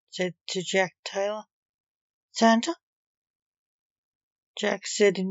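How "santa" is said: mildly incredulous guess, pitched up; puzzled question. A narrator's reading. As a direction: ask it higher and louder, voice rising. Jack guesses at stranger's identity.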